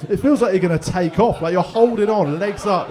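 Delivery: loud, laughing; loud laughing telling. Real, not acted. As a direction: build to the punchline. Graphic punchline.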